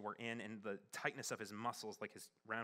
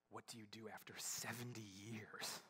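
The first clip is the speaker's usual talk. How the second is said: tearful whisper; 6 dB quieter than usual. Real, not acted. Crying while recalling the death.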